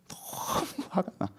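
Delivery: slow, breathy, hushed fuming; acted seething anger. Preacher acting envy of the wicked, seething. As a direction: seethe it out under the breath, drawn out. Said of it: "화내요".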